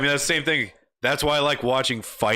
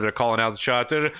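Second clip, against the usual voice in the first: ordinary delivery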